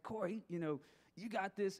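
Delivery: half-hearted attempt at a raspy voice; raspy coach voice. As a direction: try a little rasp, not quite managing it. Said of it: "he had this little raspy voice".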